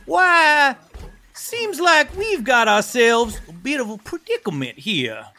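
high, theatrical villain sign-off; exaggerated high-pitched outro voice; put-on character voice. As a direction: play the episode cliffhanger in character. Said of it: "Ambriel looks around and says".

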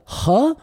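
huh as a sample sound, asked. Shown as a demonstration. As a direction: sound out huh with a breathed h, questioning. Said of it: "what is a J in Spanish".

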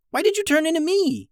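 very high-pitched, upset question; shocked outcry. A narrator's reading. Harvey asks why Reed became him, high shocked outcry. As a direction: wail the question high in disbelief. Brother shocked at seeing himself.

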